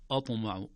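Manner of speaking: "أطمع" opened with a thick, dark first vowel; wrongly heavy hamza. Shown as a demonstration. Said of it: "ليس هناك آ". («أطمع» بتفخيم الهمزة خطأً)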